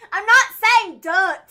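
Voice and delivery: slow, loud, high insistence; exasperated correction. Real, not acted. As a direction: correct them loudly, word by word.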